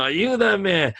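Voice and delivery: high pleading cry for help in Spanish; mock-screaming for help in Spanish. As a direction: beg high like he's being beaten. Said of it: "like, in Spanish".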